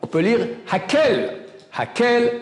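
Hakel said with a clear e, not Hakol; read as Hakel. Shown as a demonstration. Rabbi showing how to read the spelling, Hakel with e. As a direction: say Hakel, with the vowel e instead of o. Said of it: "écrit sans le Vav".

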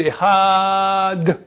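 "Echad" with a long held a before the d; slow "Echad", a sustained until the final d; drawn-out ending syllable. Shown as a demonstration. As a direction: stretch the second syllable of "Echad". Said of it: "on peut pas rallonger".

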